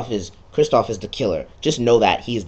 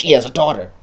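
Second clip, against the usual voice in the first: louder, pressed announcement; loud reveal; 4 semitones higher, 7 dB louder, faster than usual. Shown as a demonstration. Player hushing the audience to reveal the daughter, said louder. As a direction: lean on it like a big secret. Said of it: "Shh, shh".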